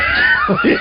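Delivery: sudden high laughing shout. Real, not acted. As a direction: burst out laughing. Loud burst of laughter.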